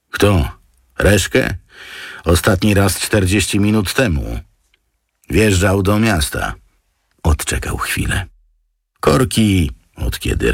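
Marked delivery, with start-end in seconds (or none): 0.1-6.5 higher-pitched underling answering; aide's voice. A narrator's reading. Aide reporting to the boss on Reszke, higher-pitched underling voice. 9.0-9.7 higher-pitched underling answering; aide's voice. A narrator's reading. Aide reporting to the boss on Reszke, higher-pitched underling voice.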